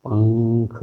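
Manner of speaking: पंख with the nasal said as a velar ng before kh; पंख spoken slowly, nasal sliding into the kh; पंख, अनुस्वार को ङ की तरह बोलें; Hindi anusvara demo. Shown as a demonstration. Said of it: "इसका जो उच्चारण हम करते हैं".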